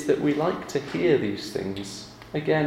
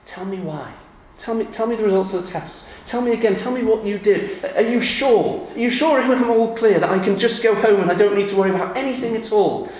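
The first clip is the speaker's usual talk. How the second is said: rising, louder, hurried begging for reassurance; anxious pleading; 6 semitones higher, 6 dB louder than usual. Retold or imitated. Patient begging the doctor for reassurance, anxious and pleading. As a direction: press the doctor for more, anxious. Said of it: "I'd say".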